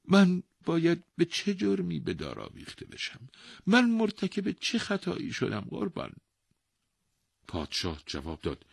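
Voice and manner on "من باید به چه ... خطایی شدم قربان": high-pitched, wailing plea; terrified protest. A narrator's reading. Old man asking the king what his crime is, terrified. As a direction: protest innocence in a high, pleading voice. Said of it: "به وحشت افتاد".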